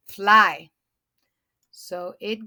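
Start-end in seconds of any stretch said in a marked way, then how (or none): 0.1-0.7 FLY in one quick, loud beat; said short. Shown as a demonstration. Teacher showing a short word, FLY quick and loud. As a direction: punch out FLY as one syllable. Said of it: "and it's a short".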